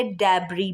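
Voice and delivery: डेब्री ending in "bri", the s silent; correct debris. Shown as a demonstration. Teacher corrects डेब्रीज to डेब्री, s silent. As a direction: say डेब्री with no final s. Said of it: "डेब्रीज नहीं बोलेंगे".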